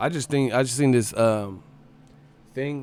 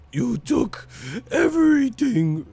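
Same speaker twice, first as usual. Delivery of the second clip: high, slow theatrical wail; much higher, hammed-up drama voice; put-on dramatic voice; 12 semitones higher, slower than usual. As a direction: stretch "everything" out like a stage villain. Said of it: "why are you talking like that".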